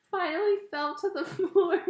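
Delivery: reading through giggles; fighting laughter to read it; laughing. Real, not acted. Reader trying the line again, laughing. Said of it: "I can't even say it".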